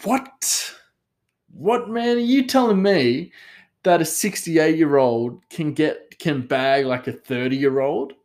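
high-pitched, incredulous "what, man?" reaction; retold disbelief. Viewer stunned by the Entrapment age-gap romance, incredulous. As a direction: act out being floored, pitched well up at first. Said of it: "And that was like".

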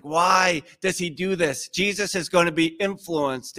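normal talking voice

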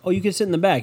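imitating a mom offering a seat; girlfriend's mom. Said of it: "And she's like".

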